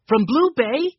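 put-on excited high shout of a boy. A narrator's reading. Benny shouting to Grandfather about the ships, excited shout. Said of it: "shouted Benny".

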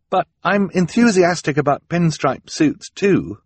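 put-on high girl's voice, putting on enthusiasm; politely feigned delight. A narrator's reading. Violet pretends to like her present, feigned delight. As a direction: pitch it up and sound keen to please. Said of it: "always say that you are delighted".

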